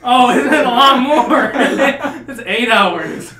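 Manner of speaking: talking through real laughter; laughing. Real, not acted. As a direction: laugh out the words slowly. Laughing at his bad math.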